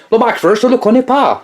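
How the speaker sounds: put-on English accent in French, pitched high. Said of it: "Je vais prendre l'accent anglais".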